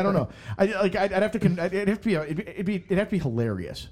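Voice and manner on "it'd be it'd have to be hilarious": normal talking voice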